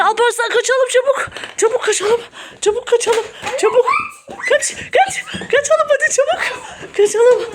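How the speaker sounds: very high, frantic mock panic; panicked escape, pretend. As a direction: urge everyone to flee, playing scared. Fleeing the pretend vampire.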